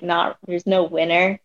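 usual speaking voice

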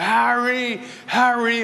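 high retold call for a lost son; calling out a name. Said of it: "we're shouting out".

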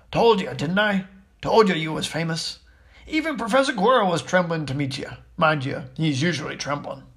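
rustic giant, pleased and proud; chummy, self-satisfied character voice; Hagrid, chummy. Acted. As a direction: play a hearty, folksy big fellow.

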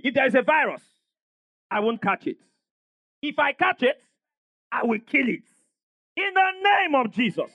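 firm phrases with pauses for repeating; leading a declaration. Shown as a demonstration. Pastor leading congregation against a virus, firm declaration. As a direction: build to a high, emphatic finish. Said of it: "Say with me".

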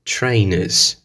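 Trainers with no r, tɹeɪnez; British trainers. Shown as a demonstration. Teacher models the British word. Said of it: "In the British English".

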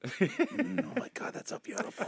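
breathless whisper of wonder; hushed, awestruck whisper; whispered awe. Retold or imitated. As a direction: whisper it like he's seeing art. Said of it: "So he goes like".

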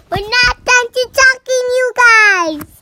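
loud sing-song shout. Real, not acted. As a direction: belt it out to the audience. Kid yells to audience.

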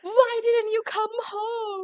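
high, slow, stretched parental whine; worried parent. Pretend. Friends acting parents scolding a late child, high and slow. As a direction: plead like a hurt dad.

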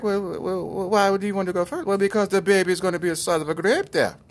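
high put-on character voice; hammed-up high-voiced questioning and answering; mock back-and-forth debate. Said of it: "And they were like".

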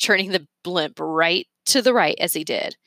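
ordinary delivery, nothing marked

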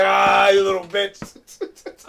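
loud, long-drawn angry cry; mad outburst. Retold or imitated. Imagining what was said on the mic, loud mad groan. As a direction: stretch the insult out in loud mock fury. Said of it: "I was so mad".

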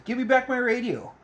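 high-pitched imitation of her demand; put-on high voice, demanding it back; mock demand. Ali demands her radio back from Johnny, high mock demand. Acted demand in film retelling.

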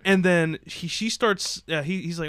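ordinary talk, nothing marked

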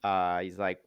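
usual speaking voice

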